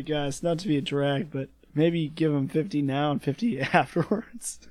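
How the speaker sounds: ordinary delivery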